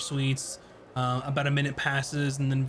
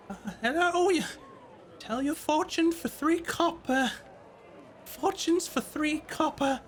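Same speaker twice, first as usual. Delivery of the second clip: put-on old frail voice; 13 semitones higher than usual. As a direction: creak it out like a frail old fortune teller. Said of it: "almost an old, frail voice".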